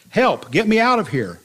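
high, loud plea to be let out; yelling for help. A narrator's reading. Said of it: "I yelled and I knocked".

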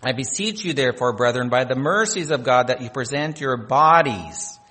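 ordinary talk

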